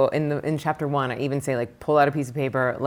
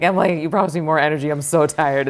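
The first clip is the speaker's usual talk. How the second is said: loud, fast whine of an exhausted client; hurried, raised-voice imitation of grumbling; mock annoyed complaint; 7 dB louder than usual. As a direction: imitate a worn-out student griping at you. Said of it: "they get mad at me".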